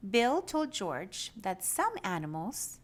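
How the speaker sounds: usual speaking voice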